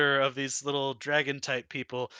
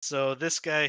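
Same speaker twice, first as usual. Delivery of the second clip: ordinary talk, nothing marked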